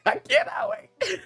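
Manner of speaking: very high, laughing squeal. Real, not acted.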